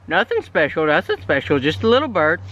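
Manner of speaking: usual speaking voice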